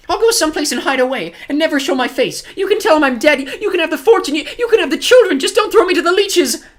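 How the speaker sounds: very high, loud frantic begging; desperate. A narrator's reading. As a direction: bargain wildly for your life. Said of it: "Aunt Josephine said desperately".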